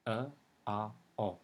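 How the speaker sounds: "A, A, O" as faint schwa, short ah, full stressed O; three different O sounds. Shown as a demonstration. Teacher breaks down the three O's, distinct vowel sounds. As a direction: separate "A, A, O", from most reduced to full vowel. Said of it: "each one of them is pronounced differently".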